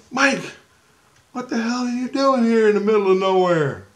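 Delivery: amazed to see a dead friend; startled. Retold or imitated. Seeing dead buddy Mike in a dream, startled high question.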